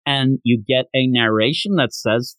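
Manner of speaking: ordinary talk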